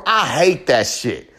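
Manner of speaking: normal talking voice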